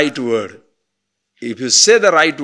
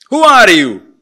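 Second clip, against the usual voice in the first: louder, higher, pointed challenge; demanding question; 6 semitones higher, 10 dB louder than usual. Acted. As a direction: call out the question sharply. Acted questioner in dialogue.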